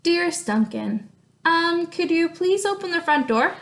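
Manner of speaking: reading a letter aloud in a lively voice; story reading. Acted. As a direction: read the crayon's letter expressively to the class. Teacher reads crayon letter aloud.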